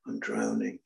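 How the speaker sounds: echoed, sinking. Shown as a demonstration. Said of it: "Outside, everything is Jack the Lad".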